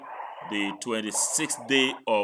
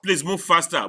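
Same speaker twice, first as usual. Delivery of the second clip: higher, louder hurry-up; impatient urging; 5 semitones higher, 5 dB louder than usual. Real, not acted. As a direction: push the speaker along. Teacher hurrying a slow presenter.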